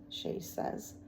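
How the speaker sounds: ordinary delivery, nothing marked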